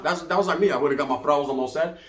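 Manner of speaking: ordinary delivery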